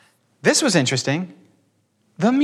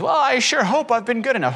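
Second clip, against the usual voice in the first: higher, anxious little voice, hammed-up; mock worried believer; 9 semitones higher than usual. Mocking the anxious hope-so believer, high worried tone. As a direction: play the unsure churchgoer lightly. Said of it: "Hope so is".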